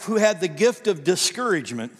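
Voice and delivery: ordinary delivery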